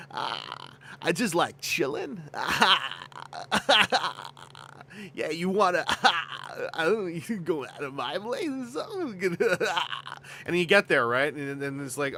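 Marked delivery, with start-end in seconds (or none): 0.1-9.4 lazy stoner voice. Pretend. Streamer voices his lazy character chilling. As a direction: play a half-asleep slacker flirting.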